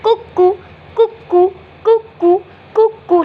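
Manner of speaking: high two-note cuckoo call; repeated, high cuckoo chimes; cuckoo clock. Shown as a demonstration. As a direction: imitate a cuckoo clock chiming. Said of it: "Like the cuckoo clock".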